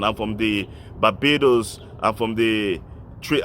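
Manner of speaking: ordinary delivery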